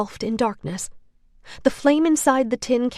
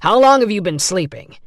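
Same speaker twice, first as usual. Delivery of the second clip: much louder, demanding; harsh interrogation; 10 dB louder than usual. A narrator's reading. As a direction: grill him hard. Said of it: "the second man said".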